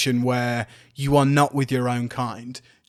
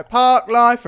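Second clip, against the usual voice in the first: high, loud Cockney shout; Phil Daniels; 10 semitones higher, 7 dB louder than usual. Acted. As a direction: belt it out in a Cockney voice. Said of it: "it's such a Phil Daniels Cockney voice".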